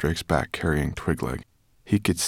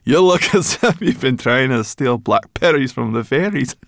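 high, loud teasing through giggles; giggling; 7 semitones higher, 7 dB louder than usual. A narrator's reading. Sorrel teases scruffy Ben, giggling. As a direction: tease with laughter bubbling round the words. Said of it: "giggling at the sight of him".

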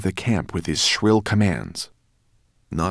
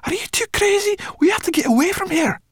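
much higher, louder, urgent; panicked; 5 dB louder, faster than usual. Acted. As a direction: hurry him in alarm. Sorrel panicking.

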